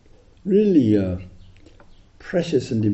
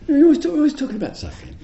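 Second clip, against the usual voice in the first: fast, much higher exasperated whine; mock complaint; 3 dB louder, much faster than usual. Retreatant complaining to the teacher, fast high whine. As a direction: pile up the accusation in a squeal. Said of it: "she said".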